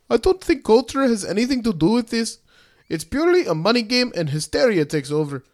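rolling the R's in a put-on accent; rolled r. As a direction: quote it with trilled R's. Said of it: "Why are you rolling your R's all of a sudden?".

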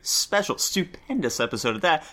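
big game show host intro; announcer voice. Acted. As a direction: hype up the show's opening. Host opening a show episode.

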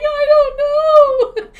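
high, loud mock sobbing; slow, high, hammed-up crying; imitating crying. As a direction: wail it like she did on the phone. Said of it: "why are you crying now".

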